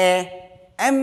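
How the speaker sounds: opening vowel এ alone, then the whole এম; teacher showing that এম starts with এ; pronunciation demo. Shown as a demonstration. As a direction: isolate এ, pause, then sound out এম. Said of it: "আমাদের মুখ দিয়ে কি আসতেছে?".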